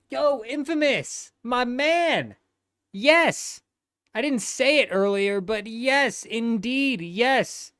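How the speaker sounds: high, hyped-up hello to a friend; light up and call out to your buddy; excited greeting. Real, not acted. Streamer greets chat regular Infamous with a high excited shout.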